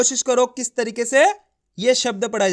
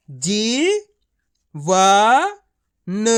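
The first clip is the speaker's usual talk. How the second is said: syllable by syllable; 3 dB louder, much slower than usual. Shown as a demonstration. Teacher spelling out जीवन for pupils, syllable by syllable. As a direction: separate syllables with pauses. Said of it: "मैं किस तरीके से एक एक अक्षर का उच्चारण करके इनको पढ़ रहा हूँ".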